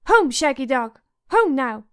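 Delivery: put-on loud child's call home; little boy calling his wolf home; mock scream. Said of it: "Rickon remembered himself and screamed".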